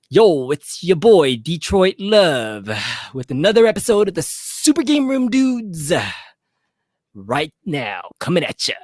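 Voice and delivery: slow, drawn-out intro voice; Detroit Love impression. Host imitates Detroit Love opening his show slowly. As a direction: drag the intro like a hype host. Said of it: "let me do my best, some Detroit love".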